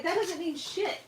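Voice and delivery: high-pitched dismissive comeback; scornful retort. Retold or imitated. Retelling her reply to the scammer, scornful.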